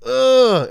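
high, loud glitchy grunt; a startled high grunt of annoyance; imitated groan. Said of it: "He'll be like".

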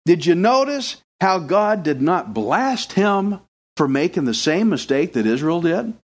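ordinary talk, nothing marked

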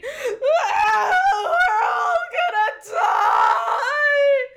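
high, loud, slow terrified wail; panicked shout. Acted. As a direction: scream in panic. King panics.

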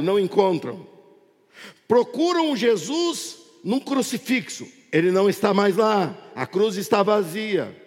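projected sermon with stretched key phrases; impassioned pulpit proclamation; fervent preaching. Real, not acted. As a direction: drive each phrase out to the congregation, drawing out the stresses. Preaches to congregation at full force.

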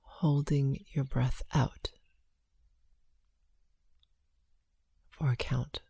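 slow, hushed breath counting. Acted.

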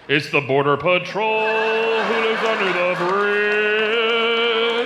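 slow, drawn-out fairy-tale voice; mock storybook punchline. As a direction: land the joke like a bedtime story.

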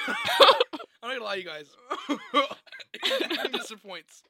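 laughing through the burn. Real, not acted. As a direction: laugh between words. Laughing while sauce burns.

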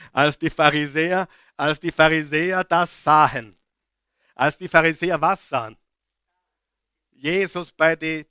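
loud, driving sermon reading of the passage; preaching at full force. Acted. Preacher reading scripture loudly to the church, fired up. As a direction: proclaim the verse loudly to the congregation.

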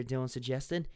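usual speaking voice

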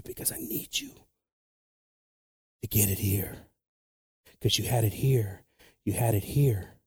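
hushed whisper. Retold or imitated. Jesus quieting his followers, soft whisper. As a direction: whisper it close and gentle. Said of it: "he still had to say".

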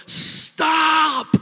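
a sudden high shouted Stop; warning shout. Shown as a demonstration. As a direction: yell it to stop a child at the road. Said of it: "scream from the top of our lungs".

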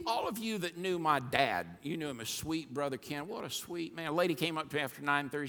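normal talking voice

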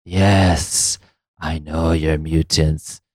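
slow, low spooky haunted house voice; Caliban voice. Pretend. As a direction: creep out each name slowly, like a haunted house host. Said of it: "do that Caliban voice again".

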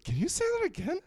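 very high-pitched mock tourist voice. Retelling strangers asking to hear the accent, high mimicking voice.

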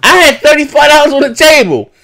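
high, loud mock woman yelling at Chad; hammed-up angry woman's voice from the background; imitating woman in background. Streamer retelling Chad's girlfriend yelling, high and loud. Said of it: "So I hear in the background".

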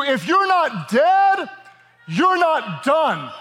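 high, slow, ringing preacher line; preaching at full force. Acted. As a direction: hammer it home to the church. Preaching climax.

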